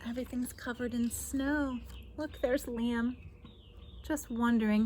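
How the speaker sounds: plain reading voice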